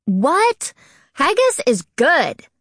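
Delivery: high, indignant splutter of protest. A narrator's reading. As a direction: splutter in defense, pitched well up. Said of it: "George spluttered".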